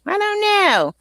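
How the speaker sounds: high, hammed-up uncertainty; playful singsong. Real, not acted. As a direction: toss it out high-pitched and exaggerated. Playful exaggerated repeat of same phrase.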